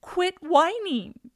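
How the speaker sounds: put-on loud, high, drawn-out toddler order; high-pitched retold reprimand; scolding child. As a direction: scold slowly and loudly like a bossy little kid. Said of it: "She goes".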